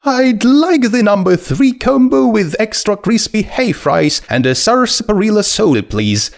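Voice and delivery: high, light skeleton voice. Acted. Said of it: "Its voice was high and light and full of energy".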